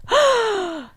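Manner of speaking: high, sharp imitated gasp; a high-pitched mock gasp of surprise. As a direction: gasp in shock. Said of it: "Mini Fury looks at Bryce and is like".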